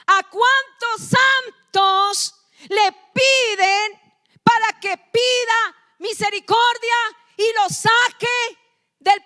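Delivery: loud, slow, hammering sermon voice; full-force preaching. Acted. Pastor thundering at the congregation against purgatory, loud and slow. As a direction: drive each word home from the pulpit.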